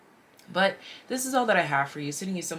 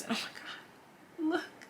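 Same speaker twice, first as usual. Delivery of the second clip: awed whisper, higher and softer; surprised whisper; 10 semitones higher, 7 dB quieter, slower than usual. Real, not acted. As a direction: gasp it out as a stunned discovery. Sudden discovery in the cards.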